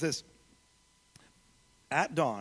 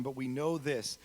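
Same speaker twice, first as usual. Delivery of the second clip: normal talking voice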